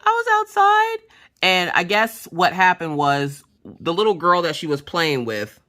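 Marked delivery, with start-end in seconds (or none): 0.0-1.0 high-pitched mock wail; whiny child. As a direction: repeat it like a tearful little kid. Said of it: "she's like".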